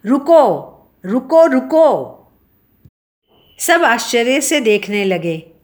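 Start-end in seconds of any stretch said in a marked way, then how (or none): 0.1-1.9 high, loud urgent cry; raised-voice, higher-pitched yell; shouted. A narrator's reading. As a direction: call out sharply to stop them. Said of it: "चीखते हुए कहा".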